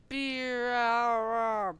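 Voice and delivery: high, loud drawn-out whoosh cry; long swooping mock sound effect; imitated flying noise. Thing flying into the fight, imitated noise.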